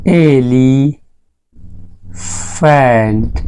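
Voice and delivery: Elephant very slowly, एली... pause... फेंट; slow sounded-out pronunciation. Shown as a demonstration. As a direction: stretch elephant into two long, separate parts. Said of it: "मैंने कहा elephant". (Elephant को तोड़कर बहुत धीरे बोलें)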